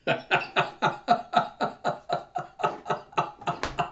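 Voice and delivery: long breathy laughing fit; rapid breathy laughter; real laughter. Real, not acted. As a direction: crack up helplessly. Cracks up while reading.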